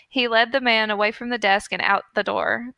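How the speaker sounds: ordinary talk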